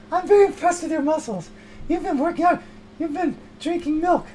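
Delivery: high, fawning praise; mock impressed high voice; gushing admirer. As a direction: coo over his muscles.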